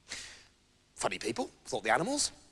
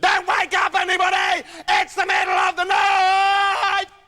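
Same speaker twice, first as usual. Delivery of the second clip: higher, louder, slow end; mock indignant shout; 9 semitones higher, 5 dB louder, slower than usual. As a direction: shout it out to wake the yard. Rooster shouting in protest.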